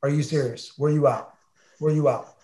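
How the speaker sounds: soft, suspicious questions; deadpan accusing stare. Retold or imitated. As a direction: imitate a roommate quietly unimpressed. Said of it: "you just looked at me like".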